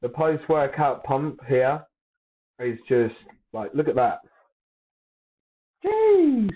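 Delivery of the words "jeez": high loud exclamation; surprised. Real, not acted. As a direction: shout out in amazement. Reacting to gym photo.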